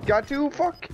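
high, rapid-fire excited callouts; pumped up mid-fight, calling kills. Real, not acted. Frantic firefight, rapid kills.

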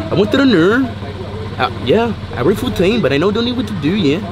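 put-on Scottish accent with lilting questions. Shown as a demonstration. Guest demonstrating accents of the world, mock Scottish accent.

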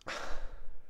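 soft, breathed-out sigh; contented sigh. Real, not acted. Collector savouring his new figures, contented sigh. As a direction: let out a quiet sigh of satisfaction.

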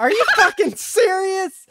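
high, loud laughing outcry; laughing disbelief. Real, not acted. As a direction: shout it through a laugh. Paddle broken, laughing disbelief.

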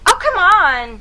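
loud exasperated cry, high; frustrated outcry. Real, not acted. Player after another failed try, loud outcry. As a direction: burst out in frustration.

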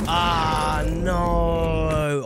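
loud pained cry of dismay; dismayed yell. Real, not acted. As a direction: cry out as the fight turns bad. Getting hit on the stairs.

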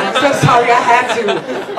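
ordinary talk

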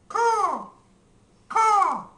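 high, loud cawing; crow caw. Pretend. Crow sound.

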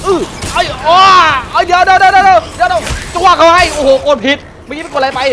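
high, loud rapid panicked cries; real panic, loud and high; frantic shouting. Real, not acted. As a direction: yell in a scramble while under attack. Frantic fight, button mashing.

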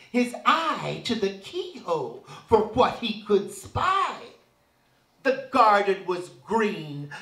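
normal talking voice

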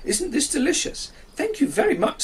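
high, relishing yum sounds; happy rooster. Acted. Cocky enjoys the toast from the sun, high and delighted. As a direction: savour the buttered toast. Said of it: "said Cocky".

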